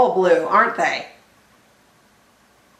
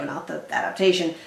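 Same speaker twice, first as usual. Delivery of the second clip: normal talking voice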